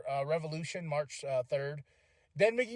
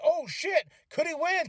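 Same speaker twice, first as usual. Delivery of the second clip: higher, excited near-disbelief; shocked surprise; 9 semitones higher than usual. Retold or imitated. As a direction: yelp it like the upset is really happening. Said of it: "I was like".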